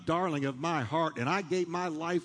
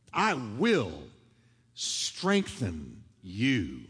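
lower, very slow, each word drawn out; slow emphatic promise; 7 semitones lower, much slower than usual. Acted. Pastor repeating God's promise to the church, drawn out slowly. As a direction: let the promise sink in slowly.

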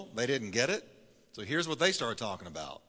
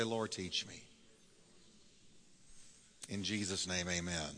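soft, lower, hushed prayer; 8 semitones lower, 12 dB quieter than usual. Acted. Pastor praying before the sermon, softly and lower. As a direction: pray it quietly over the congregation.

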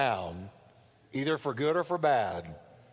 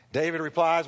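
ordinary delivery

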